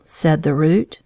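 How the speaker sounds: ordinary delivery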